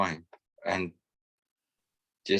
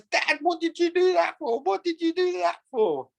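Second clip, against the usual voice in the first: put-on indignant child. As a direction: squeak out a child's outraged complaint. Child protests to dad.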